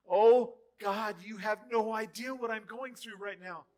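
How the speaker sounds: loud, high complaining cry; mock panicked believer; whiny desperate pray. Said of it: "much like the disciples".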